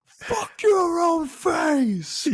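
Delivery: very high, slow hammed-up yell; mock yell. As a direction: stretch out the insult as a shout. Imitating Grossman's insult.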